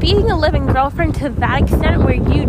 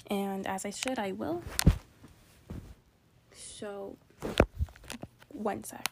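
hushed voice; 5 semitones lower, 15 dB quieter, slower than usual. Real, not acted. Speaks hushed outside.

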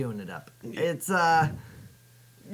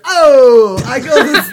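loud outburst; 2 semitones higher, 11 dB louder than usual. Acted. Grocer bursting out in protest.

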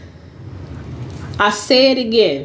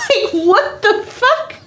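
high, laughing what the fuck; cackling at the absurdity; giggling disbelief; 12 semitones higher than usual. Real, not acted.

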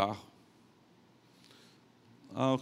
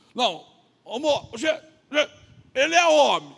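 put-on loud, higher, halting drunk; drunk man; 7 semitones higher, 8 dB louder than usual. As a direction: blurt it like an insistent drunk, in bursts. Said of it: "Aí chegou o bêbado". (bêbado insistente, alto e aos trancos)

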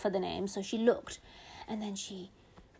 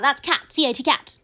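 loud eager answer; calling out; 4 semitones higher, 8 dB louder than usual. Retold or imitated. Big sister blurting the answer in class, loud. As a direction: blurt it out before anyone. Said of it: "She'd call out".

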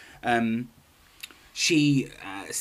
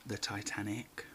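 hushed dramatic reveal; 5 semitones lower, 14 dB quieter than usual. Acted. Dramatic reveal after guess.

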